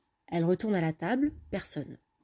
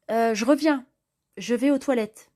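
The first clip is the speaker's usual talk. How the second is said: flustered excuse; 4 semitones higher, 6 dB louder than usual. Acted. Alicia flees awkward kiss scene.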